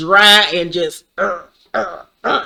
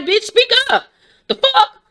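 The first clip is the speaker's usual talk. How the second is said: mock impatient. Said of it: "And I'm like".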